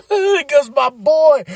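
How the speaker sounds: high, loud, drawn-out excitement; real fan hype; thrilled cheer. Real, not acted. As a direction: cheer slowly and high for a favorite. Celebrates favorite wrestler's arrival.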